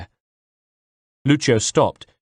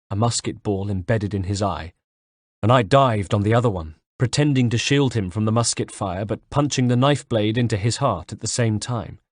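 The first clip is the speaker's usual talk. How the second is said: ordinary delivery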